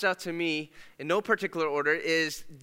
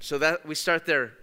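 normal talking voice

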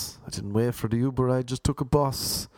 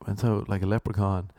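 ordinary delivery

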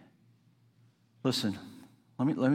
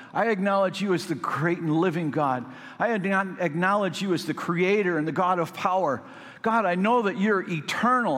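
David pouring out his heart to God, pitched high; David imitation; 6 semitones higher than usual. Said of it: "May I do my best David imitation?".

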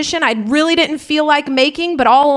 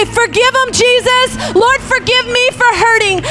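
high, ringing plea to God, building; fervent prayer; 9 semitones higher than usual. Acted. Preacher leading the church in prayer, high fervent plea. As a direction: cry out for healing and freedom. Prays at full force.

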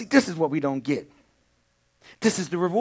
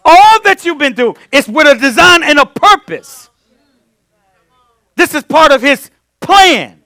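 loud, high, ringing sermon delivery; full-force preaching; 11 semitones higher, 16 dB louder than usual. Real, not acted. Preacher drives the point home to the congregation at full force. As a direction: preach it out to the back row.

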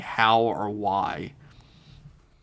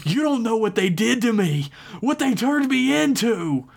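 higher, desperate cry; panicked pleading; 12 semitones higher than usual. Acted. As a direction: beg her to understand, panicking. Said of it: "panic in his voice".

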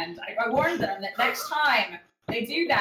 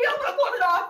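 high, laughing through the words; cracking up mid-sentence; 11 semitones higher, 5 dB louder, much faster than usual. Real, not acted. Breaks up laughing.

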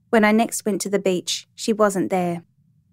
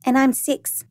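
put-on high child's voice; little girl; 5 semitones higher than usual. A narrator's reading. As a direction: pipe up like a small child. Little girl gives her age.

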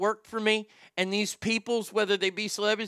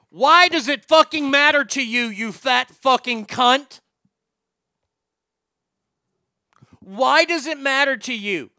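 louder and higher, spitting out each insult; furious rant; 4 semitones higher, 7 dB louder than usual. Real, not acted. Ranting at Amy Schumer, furious and loud. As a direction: tear into her at full volume. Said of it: "Here's my rant".

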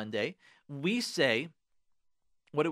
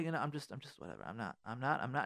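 quiet, fast and shrugging; soft yielding mumble; 6 semitones lower, 10 dB quieter than usual. Shown as a demonstration. Husband softly brushing off his wife's blame, quiet and yielding. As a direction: mumble it off gently to let it go. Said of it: "be like a Kadosh Baruch Hu and say".

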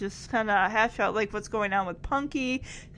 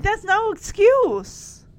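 higher, slower, pained mock protest; distraught Cherry; 10 semitones higher, 5 dB louder, slower than usual. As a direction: play Cherry blaming herself, slow and high. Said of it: "And Cherry is like".

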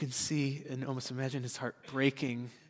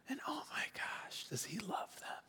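whisper; 8 semitones higher, 14 dB quieter than usual. Acted. Imagining God's delight in creation.

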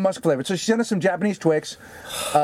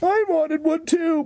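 slow, much higher mock whine; whiny baby; 13 semitones higher, slower than usual. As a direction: whine it like a spoiled child. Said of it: "I cried like a baby".

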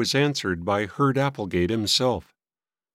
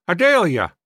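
higher, louder call down the hall; 8 semitones higher, 6 dB louder than usual. A narrator's reading. Old man summoning his sister, calling out. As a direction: call out for someone in another room. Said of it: "Then he called out".

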